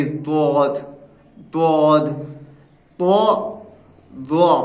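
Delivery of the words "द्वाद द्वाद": द्वाद with the tongue tip touching the palate; slow, careful teaching pronunciation; letter sound demo. Shown as a demonstration. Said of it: "ज़ुबान की नोक अपने मुंह के तालू के ऊपर जाकर टच होगी".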